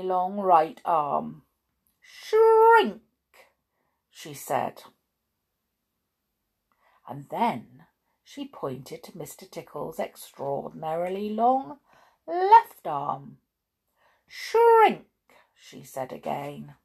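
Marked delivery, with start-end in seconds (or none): 2.0-3.0 very high, loud spell word; magic command. A narrator's reading. Little Miss Magic casts a spell on an arm, commanding. 14.3-15.1 very high, loud spell word; magic command. A narrator's reading. Little Miss Magic casts a spell on an arm, commanding.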